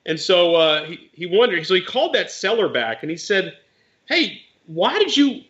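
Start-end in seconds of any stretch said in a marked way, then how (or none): 4.1-5.4 higher, drawn-out challenge; retold confrontation. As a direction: imitate someone demanding an explanation.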